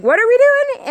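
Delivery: high, loud panicked yelp; scared. Retold or imitated. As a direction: blurt it out in a sudden fright. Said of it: "And I was really scared".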